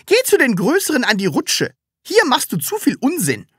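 second mother sending Sams to the slide; commanding mother. A narrator's reading. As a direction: order the child off. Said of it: "befahl eine zweite".

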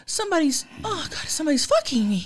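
high, flustered falsetto; high put-on voice of dawning surprise; Greg. Character in comic love scene, high and flustered.

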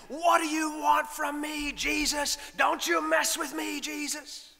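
high, loud acted shout; loud, defiant challenge pitched high. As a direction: shout at the top of your lungs. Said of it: "He shouted".

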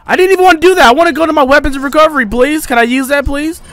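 high rapid real panic; frantic panicked pleading. Real, not acted. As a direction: rush out the words, desperate for help. Nearly dying, begging for heal.

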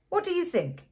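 put-on sharp high demand, a drunk man eager for an answer; demanding impetuously. A narrator's reading. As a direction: throw the question up high and hard. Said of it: "he demanded impet-, impetuously".

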